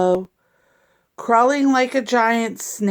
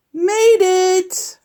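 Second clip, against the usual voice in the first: high, louder cheer of relief; triumphant; 9 semitones higher, 4 dB louder than usual. Pretend. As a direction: cheer it like you just won. Truck arrives in time.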